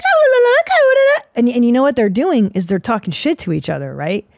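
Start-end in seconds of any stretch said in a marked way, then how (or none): 0.0-1.2 high, loud sung la la la like chirping birds; birdsong. Retold or imitated. Mimicking the dawn chorus in a high sung voice. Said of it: "all these birds are like".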